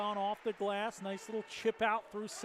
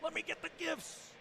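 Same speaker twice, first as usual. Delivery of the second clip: put-on eager kid at the top of the stairs; mock impatient child begging for gifts; lightly voiced greedy kid; excited kid; 6 semitones higher than usual. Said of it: "like a kid on Christmas at the top of the stairs".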